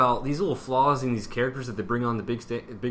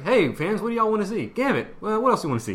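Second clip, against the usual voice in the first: mock studio exec; 8 semitones higher than usual. Mock studio asking fans.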